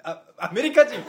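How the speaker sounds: loud, high comeback with laughter; stumble, then blurt the retort; tsukkomi retort. Acted. Comedian reacting to an English greeting, loud tsukkomi.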